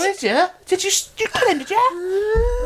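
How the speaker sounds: high-pitched incredulous interrogator; squeaky mock accusation; mock accusing police. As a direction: imitate a suspicious officer, pitch shooting up. Voicing police accusing him of killing.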